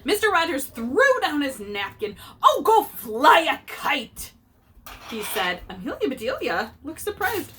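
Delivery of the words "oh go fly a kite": higher, louder and slow, drawn-out brush-off; mad dismissal. A narrator's reading. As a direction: shoo her away in a hammed-up huff. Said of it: "that he was mad".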